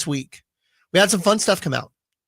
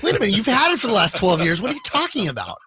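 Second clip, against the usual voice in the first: higher, indignant mock reply; mock retort; 5 semitones higher, faster than usual. Host mocking the reply to Fanatics, indignant and high. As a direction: fire back like a scoffing rival. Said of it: "It's like".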